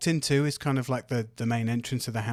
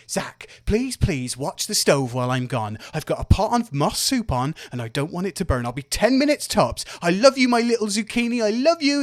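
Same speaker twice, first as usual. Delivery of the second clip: lightly voiced doting mother, higher on the endearments; doting mum; 6 semitones higher, 4 dB louder than usual. Mum fussing over her child before leaving, doting. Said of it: "she turns to you and says".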